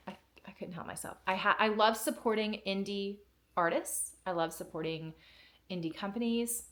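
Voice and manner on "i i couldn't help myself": guilty whisper. Real, not acted. Confessing shopping splurge.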